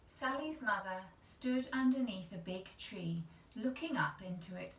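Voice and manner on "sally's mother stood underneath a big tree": ordinary delivery, nothing marked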